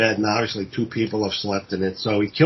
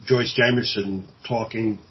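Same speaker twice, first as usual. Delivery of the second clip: usual speaking voice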